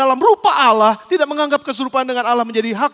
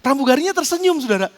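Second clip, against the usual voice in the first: usual speaking voice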